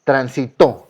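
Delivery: Transitó with TO stressed; stress on last syllable. Shown as a demonstration. Teacher asking students to listen well, stressed TO in Transitó. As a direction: punch the final to of Transitó. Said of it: "escuchen bien".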